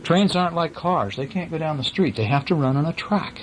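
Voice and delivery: usual speaking voice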